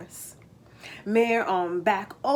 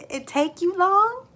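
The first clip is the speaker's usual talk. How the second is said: put-on high, louder child's question; a kid eager for more of the tale; curious boy; 10 semitones higher, 6 dB louder than usual. Jake asks Papa John about the turnip, high eager voice.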